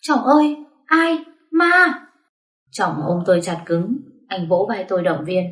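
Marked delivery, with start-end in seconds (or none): 0.0-1.9 put-on a startled girl shrieking at a ghost; terrified scream. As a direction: scream for him, scared out of her wits. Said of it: "hét toáng cả lên".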